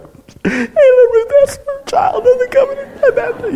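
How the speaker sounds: high, cracking, tearful cry, drawn out slowly; weepy tearful voice. Retold or imitated. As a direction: retell yourself choking up in tears at the baptism. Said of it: "I'm all weepy".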